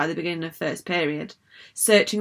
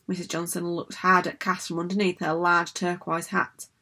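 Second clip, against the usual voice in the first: plain reading voice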